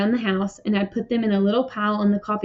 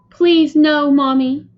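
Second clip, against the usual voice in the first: higher, louder pleading cry; a child's urgent plea; 5 semitones higher, 7 dB louder, much slower than usual. A narrator's reading. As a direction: plead loudly with Mom, pitched up. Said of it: "I cried".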